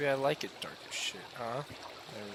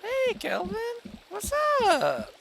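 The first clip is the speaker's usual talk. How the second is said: high-pitched call; 10 dB louder, slower than usual. Acted. Calling out to a game villager.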